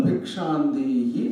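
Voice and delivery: low, long-drawn call for alms; slow, sustained low call, lifting at the end; mendicant's alms call. Acted. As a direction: draw it out slowly like a begging monk. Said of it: "అనేవాడు".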